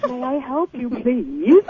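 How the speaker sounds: a lightly voiced, smooth and silky store manager; a hint of a prim saleslady offering help; mock silken politeness. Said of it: "with her silken voice".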